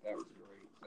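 breathy whispered echo. Real, not acted. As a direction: drop to a whisper. Echoed softly after the shout.

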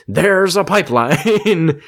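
mock Daniel. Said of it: "He's like".

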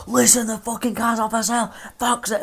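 fed-up snap down the phone; 1 mock irritated snap. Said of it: "I was like".

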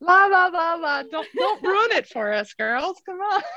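high, laughing mock protest; mock pleading, pitched high; playful refusal to listen. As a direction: drown out bad news with singsong la-las, then plead. Playfully drowning out unwelcome truth.

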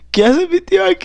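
high panicked repeat of the question; alarmed repeat. Acted. Nephew repeats, now alarmed.

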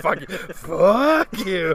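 loud, high curse swung like an uppercut; hammed-up furious imitation; mock rage. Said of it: "It was a total uppercut of a".

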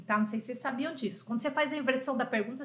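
ordinary talk

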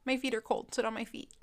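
coaxing the dog. Real, not acted. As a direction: beg the dog to come back.

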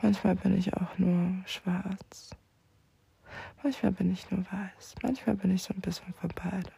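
hushed whisper throughout. Acted. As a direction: confide it under your breath.

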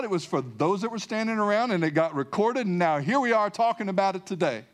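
ordinary delivery

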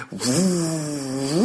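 soft, strained whooshing wind sound; blowing hard. Acted. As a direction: blow the wind with all your might. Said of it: "王大锤拼命地吹".

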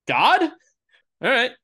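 startled. Acted. Startled by apparition.

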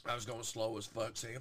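ordinary delivery

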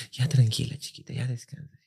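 soft, hushed soothing voice; speaking sweetly. Retold or imitated. Mom soothing the dying bird, soft and tender. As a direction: coo gently to a dying pet. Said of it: "le empezó a hablar bonito".

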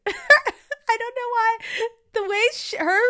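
high, slow words through laughter; laughing. Real, not acted. Laughing to her co-host about the odd accent. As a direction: crack up mid-sentence.

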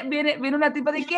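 normal talking voice

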